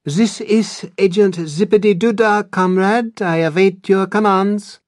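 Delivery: put-on hammy Russian spy accent; comic Russian agent voice, loud and high; fake Russian accent. A narrator's reading. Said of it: "answers in a fake Russian accent".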